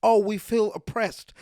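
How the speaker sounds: slow, sneering mock complaint. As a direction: mimic them with scorn. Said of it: "as you lot like to use".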